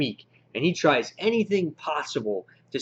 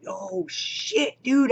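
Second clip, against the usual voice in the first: much higher, shocked yelp of a reaction; freaking out; 12 semitones higher, much slower than usual. Retold or imitated. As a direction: jolt up high like you can't believe it. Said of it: "And I'm just like".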